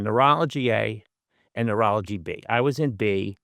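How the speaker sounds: usual speaking voice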